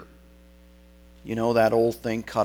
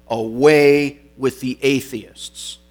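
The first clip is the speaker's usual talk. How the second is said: slow, louder proclamation as if dictating it; retold command; 6 dB louder, slower than usual. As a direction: dictate the denunciation, drawing it out. Governor dictates words to say.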